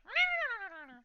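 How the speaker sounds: high, loud little critter trill; piercing creature chatter; creature squeal. Acted. Little buddy pops up, makes noise.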